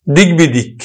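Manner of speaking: teacher sounding out দিক বিদিক clearly; pronounced example. Shown as a demonstration. Teacher showing students how দিক বিদিক is said. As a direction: say দিক বিদিক as spoken, not as spelt. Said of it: "অর্থাৎ দিক যোগ বিদিক".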